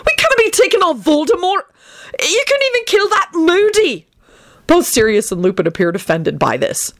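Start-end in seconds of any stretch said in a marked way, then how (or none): 0.1-4.1 high-pitched alarmed protest; panicky, high yelling; panicked. A narrator's reading. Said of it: "Benji yelled with a panicked expression".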